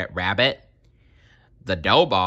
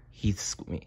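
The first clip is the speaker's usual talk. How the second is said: usual speaking voice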